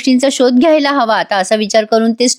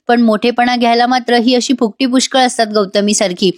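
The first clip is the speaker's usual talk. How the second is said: ordinary delivery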